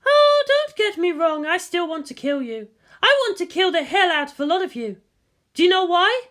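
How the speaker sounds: sweetly threatening; Misato's voice. Acted. Misato tells the soldier she still wants to kill him.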